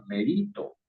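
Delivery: merito stressed in the middle, me-RI-to. Shown as a demonstration. Teacher showing student the wrong accent, merito as me-RI-to. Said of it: "usted está diciendo que la palabra se pronuncia".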